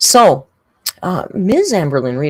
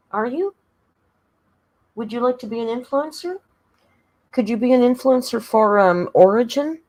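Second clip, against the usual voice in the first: high pet-talk voice; 7 semitones higher than usual. Acted. Talking to the cat.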